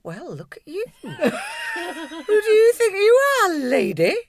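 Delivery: slow, laughing mock posh tease; teasing laugh. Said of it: "I thought".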